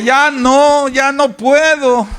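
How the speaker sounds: high, defeated moaning; mock despair of a fearful person; hopeless whining complainer. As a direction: act out someone giving up on tomorrow. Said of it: "y que dicen".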